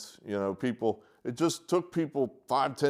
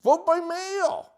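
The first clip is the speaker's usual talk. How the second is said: "Vote by mail" slow, much higher and louder; exasperated shout; 5 dB louder, slower than usual. Real, not acted. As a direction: plead loudly with exasperation. Frustrated plea to voters.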